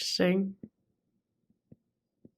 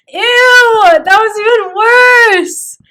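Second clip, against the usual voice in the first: laughing disgust; 12 semitones higher, 14 dB louder, much slower than usual. Real, not acted. Reacting to own bad accent.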